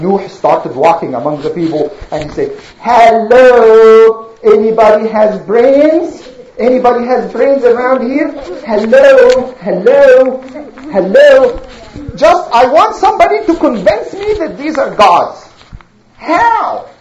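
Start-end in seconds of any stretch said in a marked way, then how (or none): 2.8-11.6 loud, drawn-out hailing; exasperated, projected hello; calling out. Acted. Nuh calls out among the people. 12.1-16.9 higher-pitched, imploring challenge; acted exasperation, pitch raised; exasperated appeal. Nuh pleads with the people.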